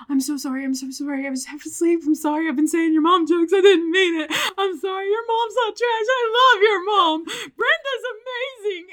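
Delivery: hammed-up tearful confession; crying apology. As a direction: beg forgiveness through mock tears. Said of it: "crying and confessing".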